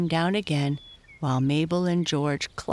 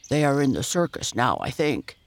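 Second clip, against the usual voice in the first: quick, put-on bear voice; bear character; 2 semitones lower than usual. A narrator's reading. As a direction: speak briskly as a storybook bear. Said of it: "said Dido".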